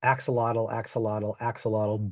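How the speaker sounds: "axolotl" said the English way, AK-suh-lah-tul; reading out guesses. Shown as a demonstration. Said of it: "You can type that in".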